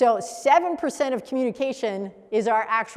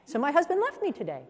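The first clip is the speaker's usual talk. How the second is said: imitated lilt rising high on "today"; upward sentence ending; 5 semitones higher than usual. Coach imitating a Mennonite client, pitch lifting towards "today". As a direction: let "left me today" climb as if happy. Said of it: "their sentences always end up".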